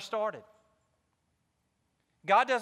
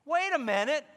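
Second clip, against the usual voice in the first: raised, higher voice of God calling him back; God stopping him short, a higher and louder reminder; voicing God; 8 semitones higher, 4 dB louder than usual. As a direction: remind him who called him, firmly. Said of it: "God's like".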